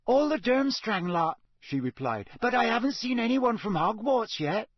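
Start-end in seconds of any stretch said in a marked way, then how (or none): 0.0-1.4 put-on high young girl's voice; a schoolgirl answering. A narrator's reading. Girl voiced in story. 2.4-4.7 put-on high young girl's voice; a schoolgirl answering. A narrator's reading. Girl voiced in story.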